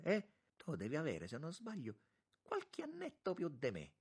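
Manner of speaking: put-on high-pitched, jovial old-friend chatter; excitable churchman teasing an old friend; effusive monsignor, chummy. A narrator's reading. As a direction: reminisce fondly in a high voice.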